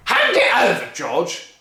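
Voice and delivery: bully the whole family; furious Grandma. Acted. Grandma demanding her breakfast, loud bullying bellow.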